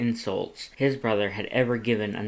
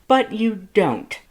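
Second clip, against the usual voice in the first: slow, high, loud insistence; Papyrus interrupting; 11 semitones higher, 7 dB louder, slower than usual. A narrator's reading. Papyrus interrupts Sans, slow, high and loud. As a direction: cut him off, leaning on each word.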